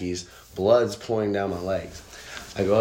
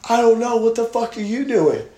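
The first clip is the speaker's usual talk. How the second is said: loud, high retort to the cops; acted high-pitched yell; panicked shouting back; 7 dB louder than usual. Retold shouting back at armed cops.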